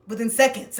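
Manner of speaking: ordinary talk, nothing marked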